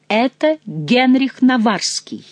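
slow, drawn-out accusing cry; exclaim. Retold or imitated. Mother to son, naming the enemy, drawn-out exclamation. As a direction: proclaim the name like a verdict. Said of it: "Воскликните".

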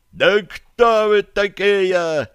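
high, loud, drawn-out outcry; outraged holler, stretched out; indignant shout. A narrator's reading. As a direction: yell it slowly in outrage from across a hall. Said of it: "прозвучал возмущённый окрик из зала".

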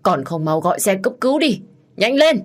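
urgent shouted demand, rising to the end; loud angry yell. A narrator's reading. Man holding wounded woman demands an ambulance, yelling. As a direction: yell for help, ending high and loud. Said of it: "nhìn đến đám người ở phía trước quát lớn".

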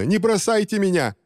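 high-pitched frightened plea; desperate cry. A narrator's reading. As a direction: beg in an acted, high cry. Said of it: "услышала она крик Рубина". (отчаянная мольба, высоко)

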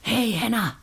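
hoher Ruf nach jemandem; Zuruf. Acted. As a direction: jemanden herbeirufen, mit angehobener Stimme. Ruft Henner wegen des Feuers.

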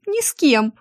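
high little squeak. A narrator's reading. Secretary denying flirting to looming boss, high squeak. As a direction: squeak it out like a cornered mouse. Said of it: "пискнула я".

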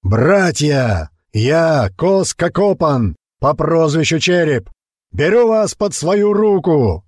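high, slow rallying cry; rally the crowd from the rock; booming speech. A narrator's reading. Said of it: "Его голос грохотал над лагерем".

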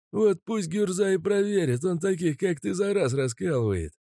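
high, sneering bandit voice; menacing thug voice. Acted. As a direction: threaten with a gun, jeering. Mazut mocks Kostya.

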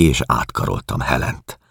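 ordinary delivery

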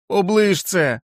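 high shouted command; high urgent shout; urgent cry. Acted. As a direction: call out sharply. Jack crying out to wolf.

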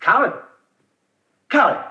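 high call across the room. Acted. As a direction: call his name to stop him. Calling out to the prince.

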